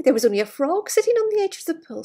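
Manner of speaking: fast, high, loud rush; excited rush. Acted. Storyteller rushing to reveal the frog, fast and high.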